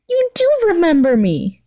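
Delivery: put-on high, sing-song girly voice; a sweet, high obsessive schoolgirl; gushing villain girl. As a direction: play the clingy yandere girl, all sugar. Toga voiced in read story.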